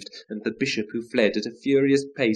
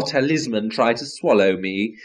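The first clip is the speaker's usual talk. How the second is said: ordinary delivery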